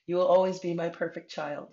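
tender, high voice near tears; loving words while really welling up; choked-up comfort with a small laugh; starting to cry. Real, not acted. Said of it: "I really am starting to cry".

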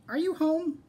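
high-pitched call from outside. A narrator's reading. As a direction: call high to someone indoors. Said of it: "someone yelled outside".

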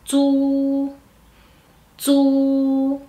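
"zu" as dz plus a long level u; Mandarin ZU. Shown as a demonstration. Teacher modelling Z U for learners, "zu" held level. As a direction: draw out "zu" on one even pitch. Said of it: "tenemos Z U".

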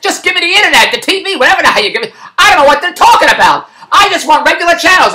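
high-pitched retold frustration; exasperated demand. Retold exasperation at package options.

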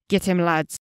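loud dwarf rallying call; angry cry. A narrator's reading. Dwarf leader sets his men on the elf, angry cry. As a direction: cry out the order with anger. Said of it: "angrily cried out".

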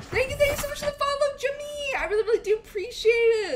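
very high, hyped streamer thanks; excited shout-out. Real, not acted. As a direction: open loud and high, keep it up. Streamer thanks new follower.

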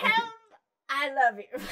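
hammed-up German Caroline; mock German accent. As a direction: declare it in a put-on German accent. Acting German Caroline to her brother.

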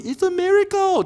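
high-pitched mock amazement; amazed exclamation. Doctor seeing cancer disappear, high amazed exclamation.

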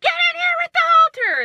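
very high, loud frantic yell; reenacted high-pitched screaming; retold scream. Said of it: "I started screaming".

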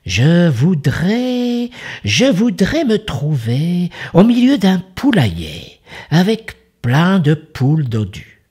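a greedy fox asking sweetly; sly fox voice. Acted. Fox makes a wish.

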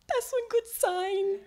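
high, laughing through the words; delighted laugh. Real, not acted. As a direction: laugh with joy at the sign. Dolphins appear, delighted thought.

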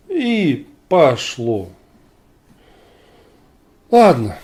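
ordinary talk

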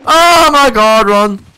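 slow high panicked scream; being chased. Real, not acted. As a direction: yell in real fright to flee. Fleeing a monster again.

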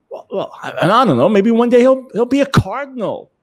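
a hammed-up father starting to bristle.

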